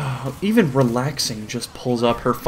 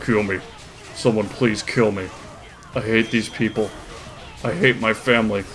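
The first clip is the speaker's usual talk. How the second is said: low, quiet, defeated monotone; low deadpan misery; despairing talking dog; 4 semitones lower, 4 dB quieter than usual. Acted. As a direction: drop low and plead wearily between pauses. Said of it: "dog is still looking pissed as ever".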